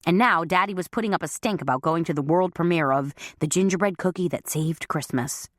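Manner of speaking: plain reading voice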